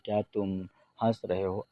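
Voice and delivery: ordinary talk, nothing marked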